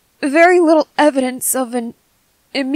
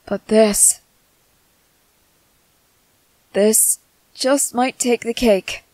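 ordinary talk, nothing marked

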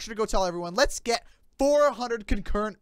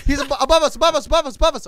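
high, loud urgent warning; urgent callout; 7 semitones higher, 7 dB louder, faster than usual. Real, not acted. Warning his teammate of an enemy above, high and loud. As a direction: call it out fast to your teammate.

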